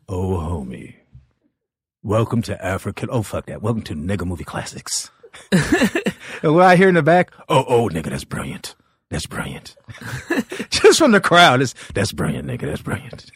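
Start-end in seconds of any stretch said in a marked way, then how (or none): 0.1-5.1 a low, quiet imitation of Paul on stage; lightly voiced comic hosting his bit; mimicking Paul's act. Comic mimics a fellow comedian telling his joke, low and quiet. 7.5-9.8 low, hushed voice from the back of the room; crowd voice. Retold or imitated. Comedian imitates an audience member, low and hushed. 11.9-13.3 low, hushed voice from the back of the room; crowd voice. Retold or imitated. Comedian imitates an audience member, low and hushed.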